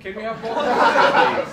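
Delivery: words pushed through laughter; laughing. Real, not acted. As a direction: laugh while asking. Laughing mid-request.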